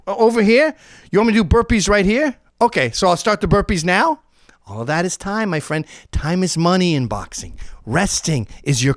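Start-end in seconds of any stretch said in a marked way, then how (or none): 0.1-4.2 high, loud dumb questions; stalling questions. Retold or imitated. Boxer stalling the coach, loud dumb questions. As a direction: play dumb loudly to waste time. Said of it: "Bore them".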